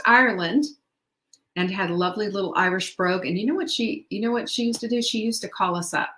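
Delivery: ordinary delivery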